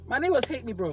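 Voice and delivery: ordinary talk, nothing marked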